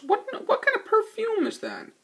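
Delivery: high teasing voice while kissing Ariel; mock flirty question. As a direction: ask it playfully mid-kiss. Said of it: "and goes".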